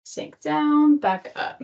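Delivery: usual speaking voice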